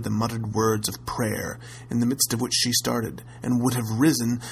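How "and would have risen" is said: ordinary delivery, nothing marked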